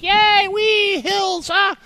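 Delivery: put-on high, loud kid squeals; excited kids. Voicing his kids cheering in the back seat, high and excited. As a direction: squeal like thrilled little kids. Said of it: "my kids are like".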